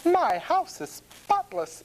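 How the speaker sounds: high-pitched storybook housewife; farmer's wife. A narrator's reading. Farmer's wife planning her cleaning, high and purposeful. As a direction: announce it in a high woman's character voice. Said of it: "says the farmer's wife".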